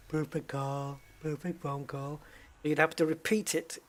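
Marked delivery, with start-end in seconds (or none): 0.1-2.2 a light take on Trump, repeating the phrase; Trump impression. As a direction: echo the words in Trump's repetitive style. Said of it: "He'd go".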